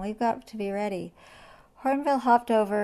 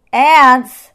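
outraged; 6 semitones higher, 20 dB louder than usual. Acted. Lion outraged.

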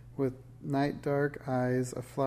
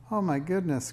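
cried out; 6 semitones higher, 4 dB louder than usual. A narrator's reading. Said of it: "cried the Nixie".

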